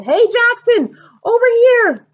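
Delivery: high, loud call from far away; loud high long-distance call. A narrator's reading. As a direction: call across the stands. Before yelling.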